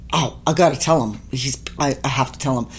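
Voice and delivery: soft, low mock inner debate; low aside to self. Deciding whether to confront him, murmured to self. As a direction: murmur it as if thinking aloud.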